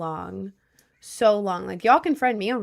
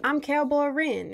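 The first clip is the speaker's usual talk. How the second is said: higher, slow Southern cowboy drawl; Southern cowboy accent; 6 semitones higher, slower than usual. Pretend. Streamer showing chat a Southern accent, cowboy twang. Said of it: "I can do a Southern accent".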